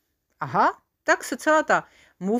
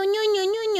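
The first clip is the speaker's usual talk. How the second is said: put-on a much higher, baby-talk coo; 13 semitones higher than usual. A narrator's reading. Soldier coos at the wild sheep, high baby talk. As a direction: coo over a cute sheep. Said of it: "dělá na ní ňu".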